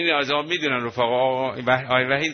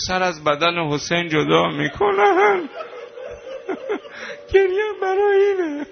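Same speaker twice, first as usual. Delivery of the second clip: crying lament; 14 semitones higher, 4 dB louder than usual. Real, not acted. Preacher sobbing to the mourners about Hussein's head. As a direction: voice breaking high into sobs over Zeinab.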